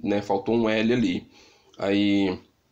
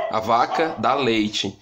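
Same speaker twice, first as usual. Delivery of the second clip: slow dictation; slower than usual. Shown as a demonstration. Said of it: "uma frase que a criança construiu".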